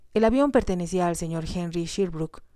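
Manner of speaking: ordinary delivery, nothing marked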